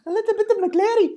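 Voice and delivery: very high, loud Irish squeak; mock the Irish leprechaun voice; shrieky copycat Irish accent; leprechaun mimicry. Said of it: "I think of leprechauns".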